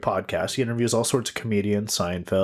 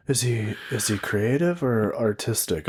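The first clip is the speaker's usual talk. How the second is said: usual speaking voice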